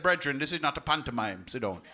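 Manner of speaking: low, stern Jamaican put-down; Jamaican accent. Retold or imitated. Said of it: "I said".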